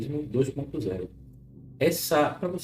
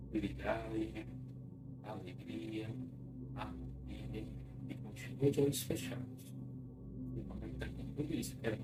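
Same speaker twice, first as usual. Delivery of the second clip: guided hypnosis; 2 semitones lower, 9 dB quieter than usual. Acted. Leads a clinical hypnosis session.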